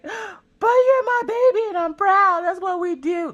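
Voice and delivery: high, slow, mock-weepy pride; hammed-up tearful "you're my baby"; teary proud mom. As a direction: gush as if about to cry with pride. Proud mom answering embarrassed daughter.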